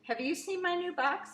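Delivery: high, bragging voice; a proud little braggart asking; boastful show-off. A narrator's reading. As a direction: show off your new toy. Tuck showing off, strutting.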